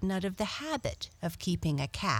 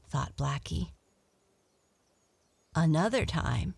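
ordinary delivery, nothing marked

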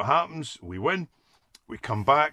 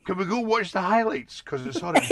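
high, sing-song mock wife voice; imitating wife's teasing plea; wife's sweet request; 11 semitones higher than usual. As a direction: play the wife asking nicely.

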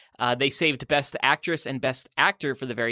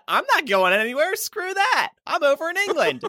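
higher-pitched mock quote; Anthony Hopkins; 12 semitones higher than usual. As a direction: pitch it way up, dismissive. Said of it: "Anthony Hopkins said".

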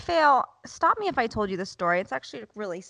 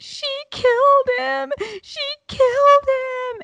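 imitated hysterical crying; 6 dB louder than usual. Son accuses his sister to mom, imitated hysterical wail. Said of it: "hysterically crying".

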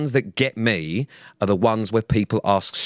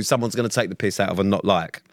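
ordinary talk

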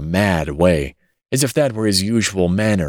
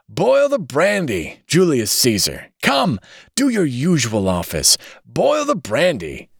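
put-on fierce drunkard; 7 semitones higher than usual. A narrator's reading. Drunkard with saucepan orders his associate, fiercely. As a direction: order it like a wild, insistent drunk. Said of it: "He became so fierce in his gesticulations".

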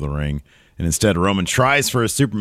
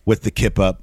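ordinary talk

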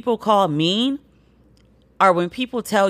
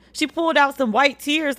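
normal talking voice

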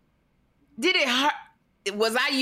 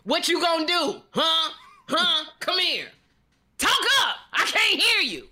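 tough Kim on a date; 6 semitones higher than usual. Retold or imitated. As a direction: push him to speak up, bossy. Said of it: "what does Kim sound like".

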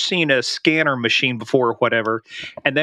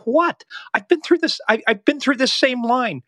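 high, indignant retold complaint; exasperated protest; 8 semitones higher than usual. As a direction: replay your airport outburst. Retold argument with security guard.

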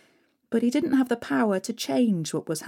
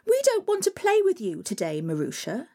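spoilt stepsisters; 2 semitones higher than usual. Acted. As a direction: sneer it like a spoilt sister. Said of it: "said the stepsisters".

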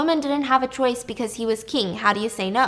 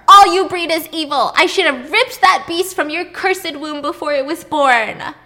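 higher, loud furious yell; screaming; 7 semitones higher, 9 dB louder than usual. Retold or imitated. Minos screaming at his wife, furious. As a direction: hurl the curse at her. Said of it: "Minos screamed at her".

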